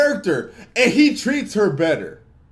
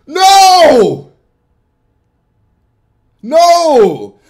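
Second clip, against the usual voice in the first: loud, high, emphatic shout; shouted refusal; 6 semitones higher, 9 dB louder than usual. Acted. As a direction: bellow a flat-out refusal. Refusing invitation to Madea movie.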